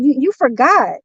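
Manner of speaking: ordinary talk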